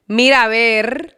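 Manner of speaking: Mira, ver with a hard trilled R, slow; rolled R. Shown as a demonstration. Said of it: "rolling my R so hard".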